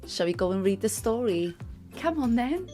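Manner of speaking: ordinary delivery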